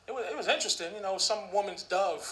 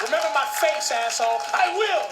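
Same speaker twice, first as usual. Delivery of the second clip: louder, higher tough-guy threat; mock threat; 5 semitones higher, 6 dB louder than usual. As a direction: bark it like a street threat. Threatening exchange.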